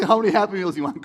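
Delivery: loud, laughing question. Real, not acted. Pastor laughing as he jokes with Chris.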